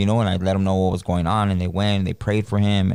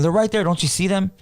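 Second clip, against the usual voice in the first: much higher, pleading voice; tripping cousin begging to be believed; scared insisting; 12 semitones higher than usual. Retold or imitated. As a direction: play the cousin seeing demons, spooked. Cousin insisting he sees demons.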